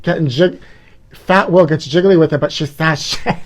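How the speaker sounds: ordinary talk, nothing marked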